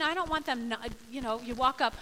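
ordinary delivery

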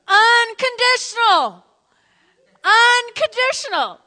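high, loud, slow proclamation; preaching shout. Real, not acted. Pastor driving home the sermon's key word, shouted. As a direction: proclaim the word with full force.

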